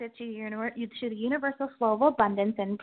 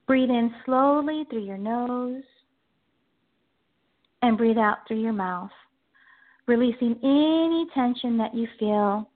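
guided meditation. Acted. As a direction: ease them into calm.

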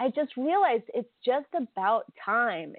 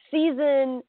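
ordinary talk

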